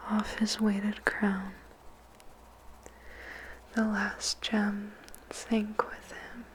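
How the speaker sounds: plain reading voice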